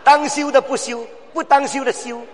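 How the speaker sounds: high, shouted sermon climax; shouting preacher rallying the church; fiery preaching. Acted. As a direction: hammer each short phrase at the hearers. Preaching at full force.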